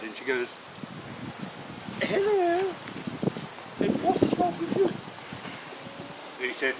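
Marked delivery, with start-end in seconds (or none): 2.0-4.9 high, drawn-out mock royal hello; hammed-up princess. Princess Anne visits a wounded soldier's bed, in a high mock-royal voice. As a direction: put on a high-pitched princess voice. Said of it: "she walks up to the first bed".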